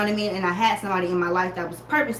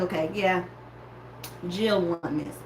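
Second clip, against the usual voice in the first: resigned concession; 3 semitones lower, 5 dB quieter than usual. Retold or imitated. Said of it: "I was like".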